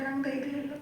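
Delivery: playfully meek, conceding to mum; meek mock confession. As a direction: mumble it like you're giving in on purpose. Repeats the admission after mother insists.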